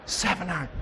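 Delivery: whispered, very soft; a tiny voice in the head, barely audible; little inner voice. Acted. Intuition whispering to the golfer over the shot, little inner voice. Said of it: "the little voice says".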